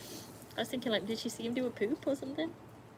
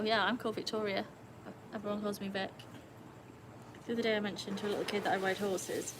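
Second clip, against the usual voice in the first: normal talking voice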